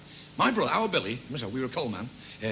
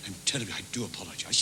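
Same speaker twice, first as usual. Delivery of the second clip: lower, softer posh apology; polite apology; 10 semitones lower, 5 dB quieter than usual. Acted. Blonde alien apologising to the little fella, soft low posh voice. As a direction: apologise like a mild gentleman.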